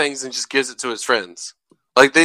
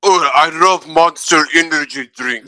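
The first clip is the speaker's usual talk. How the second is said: slow, dopey gym bro; dumb bro voice; 3 semitones higher, slower than usual. Pretend. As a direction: drawl it like a meathead bro. Mocking a bro stereotype.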